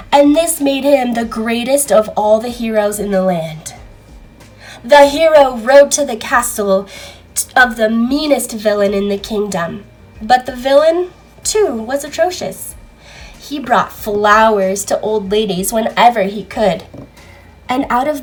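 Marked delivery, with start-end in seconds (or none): none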